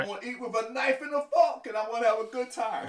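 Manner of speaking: gleeful about finally eating well; excited relish. Real, not acted. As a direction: savour it out loud, grinning. Dreaming of steak and eggs.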